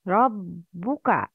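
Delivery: ربك with a full rounded u on the ب, slowly; full damma. Shown as a demonstration. Teacher modelling the damma for students, slow and rounded. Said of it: "Complete the ضمة".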